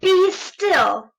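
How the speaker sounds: high, firm command to the monsters; boy king's stern command; Max commanding. Acted. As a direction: order them to freeze. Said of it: "Till Max said".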